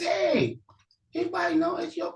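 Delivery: high, calling voice asking strangers; asking around. Acted. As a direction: shout out a question to the crowd. Elder brother asks locals.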